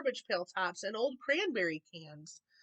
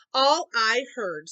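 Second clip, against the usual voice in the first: louder, slow scornful snap; snapped; 8 dB louder, slower than usual. A narrator's reading. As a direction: bite off the words dismissively. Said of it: "snapped the big kangaroo".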